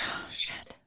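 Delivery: hushed, whispery curse; very soft retold "uh-oh" moment; under-the-breath dread. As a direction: swear to yourself, barely audible. Sees cop slowly approaching, retold.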